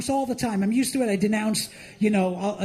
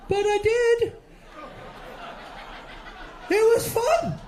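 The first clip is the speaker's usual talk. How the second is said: high-pitched; 12 semitones higher than usual. Real, not acted. Punchline, voice jumps up.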